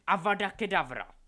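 very loud, high spell shout; cried out. A narrator's reading. Delphi strikes Craig with a killing curse, shouted. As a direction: scream the killing curse with full force. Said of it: "vykřikla Delphi".

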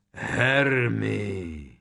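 long, booming giant's call; thundering giant. A narrator's reading. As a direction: thunder the name out slowly. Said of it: "hřímal dráb".

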